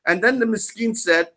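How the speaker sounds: ordinary talk, nothing marked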